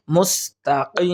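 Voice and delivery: ordinary talk, nothing marked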